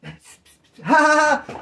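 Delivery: high, loud mock belly laugh. Shown as a demonstration. Showing how they laughed after lights out, loud hammed-up laughing. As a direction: burst into exaggerated loud ha-ha-has. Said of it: "Laughing loud".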